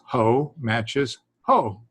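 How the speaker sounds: very slow, each ho spelled out; slow demonstration. Shown as a demonstration. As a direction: lay out ho matches ho slowly for students. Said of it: "So for instance".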